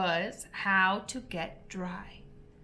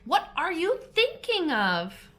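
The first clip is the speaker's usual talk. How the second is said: higher, indignant mouse; angry mouse; 8 semitones higher, 4 dB louder than usual. A narrator's reading. Mouse scolds the distracted Alice, indignantly. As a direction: snap it as a scolded question.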